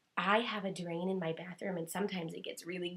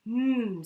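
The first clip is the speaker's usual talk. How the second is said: loud, hearty mm of tasty food; yummy mm; 3 semitones higher, 10 dB louder than usual. Shown as a demonstration. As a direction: savour it with a big mm. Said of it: "rub your tummy like".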